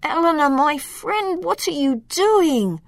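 high, dismayed plea; groaning distress. A narrator's reading. Caged elf to his captor friend, groaning distress. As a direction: plead in dismay, pitched high. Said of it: "Loriano groaned".

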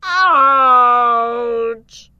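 long, loud, high "ouch"; pained wail. A narrator's reading. Dragon whacked on the nose, wailing in pain. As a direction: wail it out, holding the vowel. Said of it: "He wailed in pain".

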